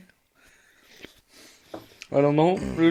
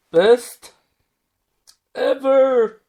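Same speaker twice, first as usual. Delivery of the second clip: high, loud excited squeal; quoting a cartoon pony's delighted line; Pinkie Pie; 11 semitones higher, 7 dB louder, much slower than usual. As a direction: burst out the words, high and loud. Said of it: "Pinkie Pie".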